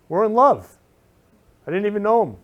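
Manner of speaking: giddy, loud burst of joy; gushing. Real, not acted. Gushing to the viewers about her driver. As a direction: blurt it out with delight.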